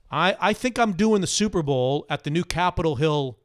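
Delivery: normal talking voice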